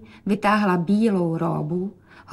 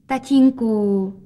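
drawn-out, louder call; showing off; 2 semitones higher, 5 dB louder, much slower than usual. A narrator's reading. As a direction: stretch the word out to get father's attention. Daughter shows off to father. (protaženě volá na tatínka)

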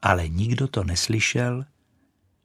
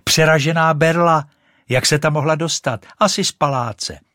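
put-on high, loud boy's exclamation; calling out; 6 semitones higher, 6 dB louder than usual. A narrator's reading. Little boy showing his friend a find, calling out. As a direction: call it out to a friend. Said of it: "vykřikl jeden ten klouček".

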